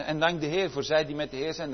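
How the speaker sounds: ordinary talk, nothing marked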